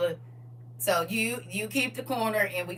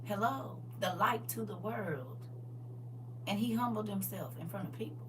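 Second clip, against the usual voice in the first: ordinary talk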